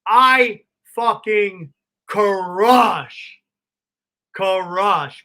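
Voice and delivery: slow, drawn-out loud crush; triumphant. Retold or imitated. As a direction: stretch the word crush in triumph. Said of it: "My dreams are being realized".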